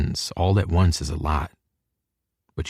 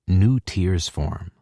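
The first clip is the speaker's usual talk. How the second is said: ordinary delivery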